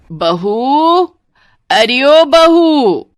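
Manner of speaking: loud, long-drawn rising holler; calling out. Acted. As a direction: yell for the bahu across the house. Calls the bahu from the door. (बहू को ज़ोर से पुकारना)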